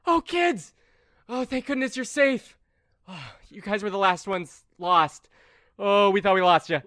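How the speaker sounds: high, loud gushing counselor; relieved counselor. Acted. Counselor finding the lost kids, high, loud and relieved. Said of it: "female counselor comes out and is like".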